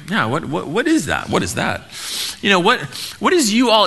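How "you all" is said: "you all" as a mock Texan y'all, pitched up in disbelief. Preacher marvels at Paul's "you all" in a mock Texan drawl. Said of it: "It sounds like Paul becomes a Texan".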